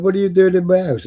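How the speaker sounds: a hint of the startled homeowners, high; lightly voiced Indian couple asking; mock homeowner. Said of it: "these fucking Indians going".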